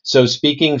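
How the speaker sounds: ordinary delivery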